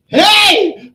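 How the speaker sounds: high, loud "hey" yell; explosive shout. Retold or imitated. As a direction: burst in with a high-pitched holler. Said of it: "Like that".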